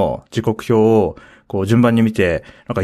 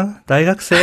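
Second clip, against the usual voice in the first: puzzled question; 9 semitones higher, slower than usual. Retold or imitated. Quoting a puzzled local's question.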